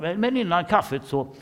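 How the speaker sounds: the boss reluctantly giving you the floor, high and loud; hammed-up boss. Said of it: "säger personalchefen". (chefen ger ordet, högt och ljust)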